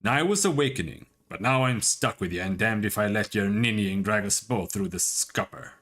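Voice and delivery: a light, rough Scottish brogue; gruff Scottish taunt, only lightly put on; mock-tough Scottish voice; Scottish accent. Isilmir argues with Aloth.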